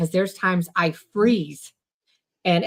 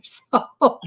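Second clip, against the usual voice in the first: short breathy laugh; 6 semitones higher than usual. Real, not acted. Laughing about not being menopausal.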